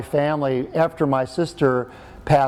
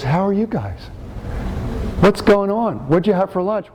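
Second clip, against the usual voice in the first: mildly hammed-up chipper dad, higher pitch; revived, cheery father; 6 semitones higher than usual. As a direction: imitate a father suddenly chatty and upbeat. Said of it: "and he goes".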